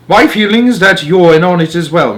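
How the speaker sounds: lightly voiced stern English father; a touch of a pompous, cross gentleman. Mr Jenkins accuses grandmother.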